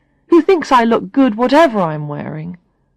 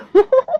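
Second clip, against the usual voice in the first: high mocking laugh; 3 dB louder than usual. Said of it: "listening to Tanya laugh".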